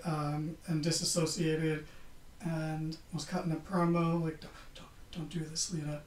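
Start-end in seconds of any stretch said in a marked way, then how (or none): 4.4-6.0 a hint of an Irish accent, much softer; barely-there Irish accent, quiet; half-done Irish accent. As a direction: softly start an Irish accent, then drop it. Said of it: "I'm not doing the accent".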